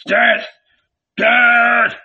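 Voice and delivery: high, loud shout from the crowd; loud rallying cry; mob cry. A narrator's reading. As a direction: yell it like a baying mob. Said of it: "was heard on every side".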